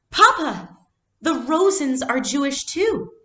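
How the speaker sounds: sudden high, loud alarm; girl realizing her friends are in danger, frightened. Acted. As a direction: blurt it out in fright.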